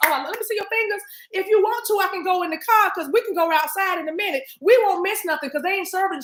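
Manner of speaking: put-on high, eager hustling girlfriend; pushy friend voice. Mimicking a friend hustling nails at the opening. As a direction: sweet-talk her into a nail set.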